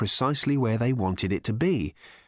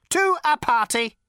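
serious robot; 6 dB louder than usual. A narrator's reading. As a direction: state it in total seriousness. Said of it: "in all seriousness".

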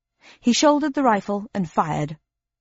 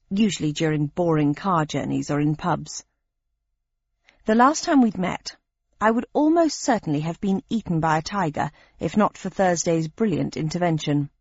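plain reading voice

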